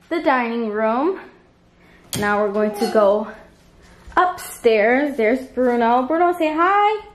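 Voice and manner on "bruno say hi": high-pitched dog-command voice; coaxing a pet. Real, not acted. Owner asking Bruno to say hi, high-pitched coaxing. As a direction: coax the dog in a raised, lilting pitch.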